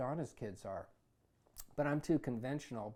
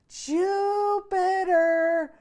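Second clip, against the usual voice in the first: long, high, very loud operatic call of the name; mother calling her son across the street, belted at full lung; belting call; 14 dB louder, much slower than usual. Acted. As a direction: belt it out like an opera singer.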